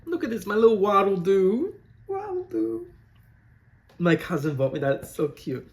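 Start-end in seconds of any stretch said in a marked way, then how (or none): 0.0-1.8 higher and louder, cooing over a cute toy; cooing delight. Real, not acted. 2.1-2.9 much higher tiny squeak for the toy; squeaky cute voice. Pretend.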